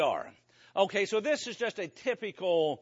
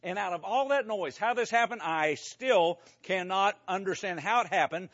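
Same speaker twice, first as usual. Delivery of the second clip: normal talking voice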